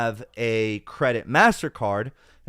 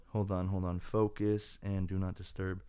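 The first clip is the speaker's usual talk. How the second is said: much softer, muttered aside; muttering to self; 5 semitones lower, 12 dB quieter than usual. Real, not acted. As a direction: mutter while fiddling with the phone. Muttering while changing phone settings.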